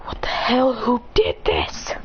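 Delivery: slow, breathless, trembling whisper; shocked whisper. Acted. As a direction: whisper it as if stunned by bad news.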